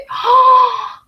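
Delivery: sharp, loud, high sob-gasp; a real crying gasp; emotional gasp. Real, not acted. Mom gasps, overcome recalling her son.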